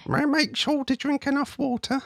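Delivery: high nagging mum voice. Shown as a demonstration. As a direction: nag like a worried mum. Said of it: "am I sounding like your mum now".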